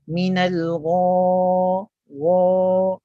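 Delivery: GHA drawn out loud with pushed-out, rounded lips; contoh salah. Shown as a demonstration. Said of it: "ni contoh yang salah". (gha panjang dengan bibir dibulatkan dan dimuncungkan)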